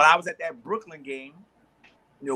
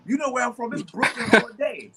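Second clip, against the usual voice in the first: proud Brooklyn fan; 7 semitones higher, 5 dB louder than usual. Retold or imitated. Retold fan's proud reply.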